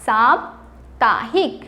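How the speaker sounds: साप्ताहिक drawn out slowly, rising at the end; साप्ताहिक stretched and clear; slow careful pronunciation. Shown as a demonstration. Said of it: "क्या है बच्चों?".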